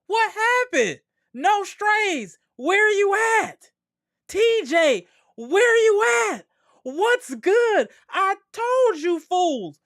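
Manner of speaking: high, loud jeering shouts; gloating taunt. Real, not acted. Streamer calling out T J after the loss, high jeering shout. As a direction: crow it out, told-you-so.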